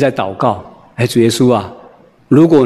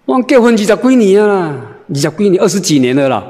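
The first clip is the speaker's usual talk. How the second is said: higher, chatty, laughing wife speaking Minnan; a hint of a laughing wife telling how long they've been married; 6 semitones higher than usual. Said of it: "讲闽南话啦".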